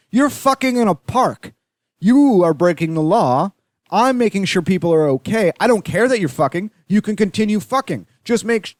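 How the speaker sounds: high-pitched, exasperated lecturing; indignant protest. Retold or imitated. Would-be helper scolding the couple in the park, indignant.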